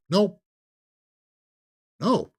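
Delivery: a loud, high, flat-out no; mock studio exec yelling no; loud refusal. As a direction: bark the no like a studio boss shutting it down. Studio refusing Coppola's pitch.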